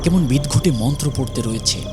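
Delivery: ordinary talk, nothing marked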